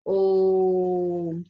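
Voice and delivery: ओम held very long, loud and steady; prolonged Om. Shown as a demonstration. Teacher demonstrating a pluta vowel, prolonged Om. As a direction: stretch the vowel of ओम out on one note. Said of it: "इसमें आपने काफी ज्यादा समय लिया".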